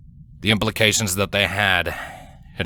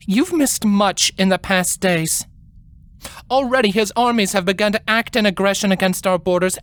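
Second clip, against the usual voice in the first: higher, grave royal lecture with authority and wrath; authoritative queen lecturing; 13 semitones higher than usual. A narrator's reading. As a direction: lay down the law to your younger sister.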